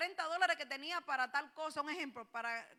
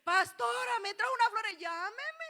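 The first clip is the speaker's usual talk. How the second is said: higher, louder happy report; excited caller; 7 semitones higher, 7 dB louder than usual. Acted. Acted member phones the pastor, excitedly sharing the flowers. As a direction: gush like a thrilled church member.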